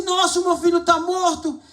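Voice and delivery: high cry of despair; a father realizing his son is gone; shocked father. Retold or imitated. Father finds son dead. (pai desesperado, agudo)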